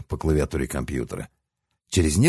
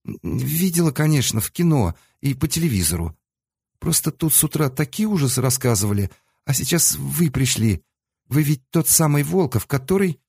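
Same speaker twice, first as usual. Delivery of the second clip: put-on high, anxious girlish voice; a timid clerk, rushing her words; nervous young woman; 8 semitones higher than usual. A narrator's reading.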